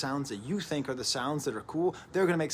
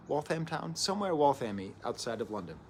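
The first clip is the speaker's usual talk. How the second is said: normal talking voice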